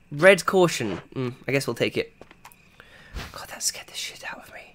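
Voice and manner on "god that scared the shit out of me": hushed relieved whisper; whispering to chat after a jump scare; shaken whisper. Real, not acted. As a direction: whisper it, heart still racing.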